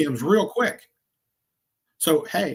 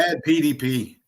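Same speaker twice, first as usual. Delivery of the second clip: ordinary talk, nothing marked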